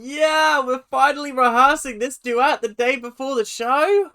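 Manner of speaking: loud, high mock-cheerful sarcasm; sarcastic outburst. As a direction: shout it with fake delight about the late rehearsal. Sarcastic rant about late rehearsal.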